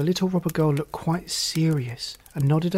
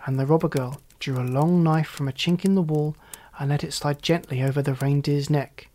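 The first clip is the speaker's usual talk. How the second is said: ordinary delivery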